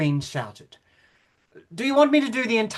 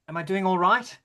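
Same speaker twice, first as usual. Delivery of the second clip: ordinary talk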